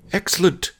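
an eager "excellent!"; pleased praise. A narrator's reading. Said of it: "he said".

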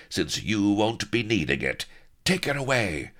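high-pitched, imperious order; commanding villainess. A narrator's reading. Pirate woman ordering the loot away, commanding villainess. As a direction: call out the command, pitched well up.

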